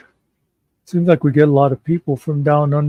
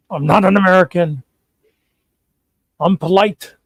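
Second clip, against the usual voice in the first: raised, mildly affronted Canadian protest; mock indignant; 4 semitones higher, 4 dB louder than usual. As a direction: protest it a touch higher, as an imagined offended traveler. Quoting Canadians mistaken for Americans.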